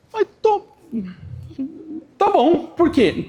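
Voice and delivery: high, hesitant plea; pleading reply. Retold or imitated.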